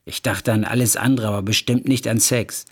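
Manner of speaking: plain reading voice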